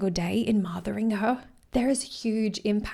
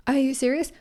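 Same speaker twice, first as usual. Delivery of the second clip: incredulous retort; 6 semitones higher, 4 dB louder than usual. Retold or imitated. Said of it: "It's like".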